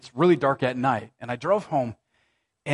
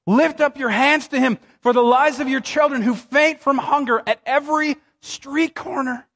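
dramatic scripture reading; 6 semitones higher than usual. Acted. Pastor reading Lamentations to the congregation, impassioned then hushed. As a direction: read the lament like a cry to God, then let it collapse into grief.

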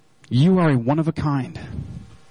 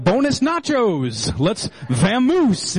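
put-on slow, drawn-out, mangled Spanish accent; hammed-up bad Spanish with a Norwegian lilt; botched Spanish accent; 4 semitones higher, much slower than usual. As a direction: read Spanish badly, stretching the words in a mock accent. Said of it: "It's not Spanish Norwegian".

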